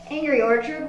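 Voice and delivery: ordinary talk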